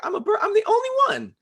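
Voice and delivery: very high, laughing squeak; squeaky mock voice. The bird watcher voiced for laughs, very high and squeaky.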